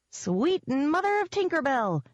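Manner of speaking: high, drawn-out astonished pixie cry; exclaiming. A narrator's reading. Pixie jerking her attention away, high exclamation. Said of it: "Jenks exclaimed".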